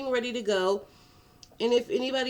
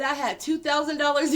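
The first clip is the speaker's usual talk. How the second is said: louder and higher, breaking into laughter; laughing; 7 semitones higher, 5 dB louder than usual. Real, not acted. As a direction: laugh at your own nerve. Milking her claim to sergeant.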